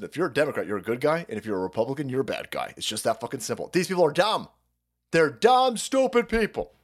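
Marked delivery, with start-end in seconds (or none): 0.0-3.7 lower, softer dumbed-down explainer; low condescending mock voice; mock simpleton lecture. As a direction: talk down like a know-it-all. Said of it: "break it down as".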